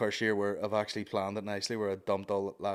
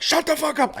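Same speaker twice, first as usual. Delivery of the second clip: high, loud comic outburst; mock furious yell; 8 dB louder than usual. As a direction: yell it like a comic blasting a heckler. Acting out comedian's heckler put-down.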